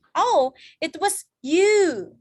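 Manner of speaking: "you" stretched long in a high, loud, slow swoop; long U sound. Shown as a demonstration. Teacher modelling long U in a sentence, slow swooping "you". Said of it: "Itu U-nya panjang".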